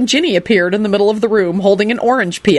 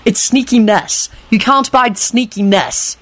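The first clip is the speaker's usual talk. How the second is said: slow, knowing correction; 4 semitones higher than usual. A narrator's reading. Jamie corrects his grandfather, knowingly. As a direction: spell it out slowly for grandpa. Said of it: "told his grandfather knowingly".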